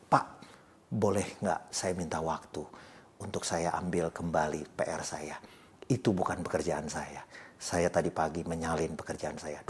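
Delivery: low, soft, hesitant admission to a teacher; subdued confession. Retold or imitated. Student owning up to lecturer about copied homework, low and subdued. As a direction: retell it as a quiet, humble confession.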